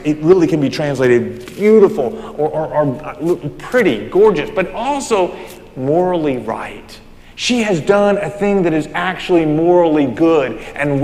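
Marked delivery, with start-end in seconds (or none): none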